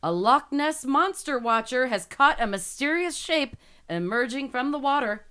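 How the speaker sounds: slow, dramatic news headline; headline read. Acted. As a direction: announce it with suspense, drawn out. Reads the headline aloud.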